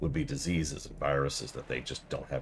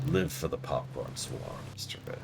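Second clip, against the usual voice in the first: whisper; 3 semitones lower, 5 dB quieter than usual. Pretend. Game chant, whispered.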